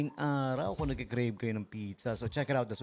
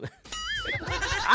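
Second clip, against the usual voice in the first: very high, far-off burst of laughter; real laughter at the punchline; squealing laugh. Real, not acted. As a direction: break up laughing.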